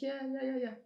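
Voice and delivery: high, sugary mock agreement; high fake-sweet yes-yes; fake agreeable. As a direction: play along sweetly while hiding annoyance. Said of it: "I'm like".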